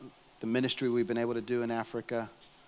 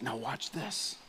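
softly voiced guide, a murmured "Now watch this."; quiet safari guide pointing something out; hushed guide; 7 dB quieter, slower than usual. Safari guide hushing the group.

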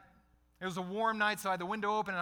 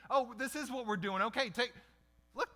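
eager crowd; 5 semitones higher than usual. Acted. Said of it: "they changed their tune".

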